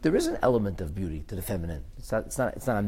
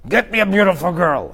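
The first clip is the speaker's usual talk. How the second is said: a hint of a crude king barking an order; crude demand; 7 semitones higher, 8 dB louder than usual. King demanding a beautiful girl from servants, lightly voiced crude order. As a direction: toss off the order bluntly, a touch higher. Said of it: "How did they express himself?".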